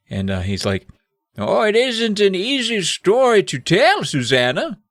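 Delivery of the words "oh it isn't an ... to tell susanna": put-on slow, high British old man; hammed-up British elder, stretched out; Morgred. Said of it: "let's try to do British again".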